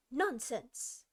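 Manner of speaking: a firm, decisive cry; loud and decided. Acted. Alice silencing the queen, firmly. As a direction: cut her off, firmly. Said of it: "very loudly and decidedly".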